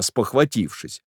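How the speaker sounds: plain reading voice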